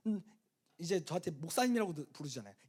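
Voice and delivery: ordinary talk, nothing marked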